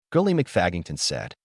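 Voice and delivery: plain reading voice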